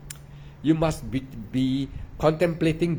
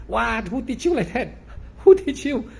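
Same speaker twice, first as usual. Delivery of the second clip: incredulous; 7 semitones higher, 3 dB louder than usual. Real, not acted. Incredulous at other teachers' method.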